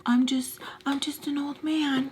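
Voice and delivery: high, soft, feeble voice; mock old man. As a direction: play helpless old man, mockingly. Mocks the man's feeble excuse.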